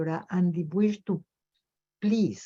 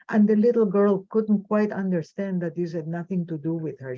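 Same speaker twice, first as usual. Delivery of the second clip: usual speaking voice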